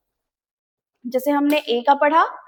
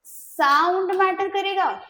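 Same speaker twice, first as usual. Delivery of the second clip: "Sound" stretched long and high in "sound matter करेगा"; slow, raised-pitch stress on "sound"; emphatic answer; 4 semitones higher, slower than usual. Real, not acted. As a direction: hold out the word "sound" to hammer the point home. Answers own repeated question emphatically.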